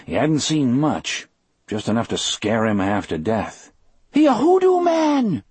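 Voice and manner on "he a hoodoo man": high, loud frightened Cajun; Cajun accent. A narrator's reading. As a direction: Cajun man shouting high. Delacroix insists, scared.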